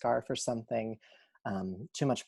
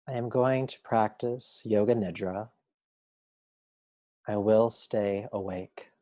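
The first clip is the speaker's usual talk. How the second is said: measured yoga nidra guide voice; unhurried, steady meditation instruction; guided meditation. Acted. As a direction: guide them calmly, a phrase at a time with long pauses.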